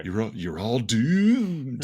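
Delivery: slow, ominous doom warning; slow ominous raspy drawl. Retold or imitated. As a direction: stretch out the doom like a horror prophet. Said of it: "the one in, like, the early Friday the thirteenth movies".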